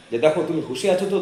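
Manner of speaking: ordinary delivery, nothing marked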